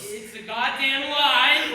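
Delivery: furious yell. Acted. Brother angrily denies the diaper story.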